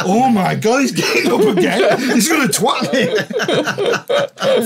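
high, drawn-out laughing dread; half-laughing mock panic, stretched out. Seeing the German getting up again, high laughing mock panic. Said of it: "I'm thinking".

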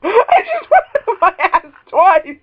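slow loud laughing yell; laughing shout. Real, not acted. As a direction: shout while cracking up.

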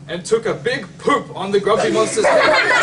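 words breaking into a high, loud real laugh; can't hold back the giggles reading it. Real, not acted. Reading the seagull poop to a child, laughing. Seagull poops on monster, reader cracks up.

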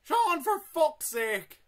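slow, drawn-out, loud and high yell; exasperated shout. Real, not acted. To the slow teammate, a slow furious yell. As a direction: stretch every word in fury.

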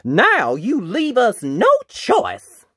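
high, loud, slow declaration; dramatic storybook battle challenge; knight's defiant yell. A narrator's reading. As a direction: yell it word by word at the enemy. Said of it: "yelled the knight".

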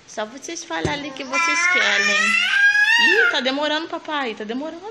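high, loud, laughing squeal; mother voices the impatient baby in a high laughing squeal. Pretend.

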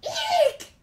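very high startled eek; high squeal. Acted. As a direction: squeak in surprise. Larry squeals.

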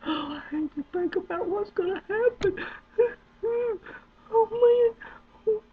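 high, laughing mock wail; laughing cry. Real, not acted. Mock dread of what's coming.

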